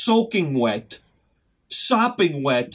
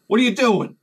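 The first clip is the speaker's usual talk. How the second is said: milder challenge, pitch somewhat raised; toned-down confrontation; 6 semitones higher, 4 dB louder than usual. Retold or imitated. Worker questions guy with a flashlight, toned-down challenge. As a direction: ask it pointedly, holding the anger back. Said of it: "maybe I don't say it like that".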